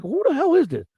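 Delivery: high-pitched, baffled demand; retold disbelief, voice shooting up; incredulous. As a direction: demand to know who is texting you. Baffled reply to unknown texter.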